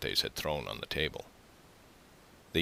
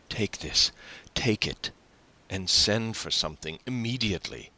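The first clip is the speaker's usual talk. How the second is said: higher, insistent generosity; eager urging; 6 semitones higher than usual. A narrator's reading. Son pushing money on his father, eager. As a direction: press it on him eagerly.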